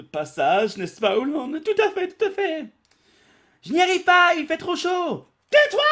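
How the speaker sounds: very high, loud nervous squeaking; high squeaky panic. Acted. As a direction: babble nervously to the giant. Kids answer nervously.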